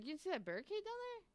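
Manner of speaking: high, disbelieving mock question; incredulous question. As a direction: act stunned he missed the barricade. Retelling questioning the intruder.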